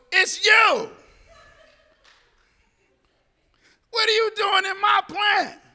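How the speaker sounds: high, loud, delighted yell; playful loud shout back at the officer across the lunchroom; excited shout. Retold or imitated. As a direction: shout back in mock accusation.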